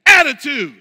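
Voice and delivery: Attitude said alone, loud and final; punchline word. Shown as a demonstration. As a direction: drop "Attitude" like a verdict after a pause. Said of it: "he had a major tude".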